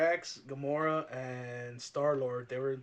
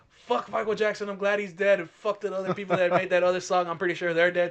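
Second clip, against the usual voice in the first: angry rant; 5 semitones higher, 8 dB louder than usual. Retold or imitated. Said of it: "I was like".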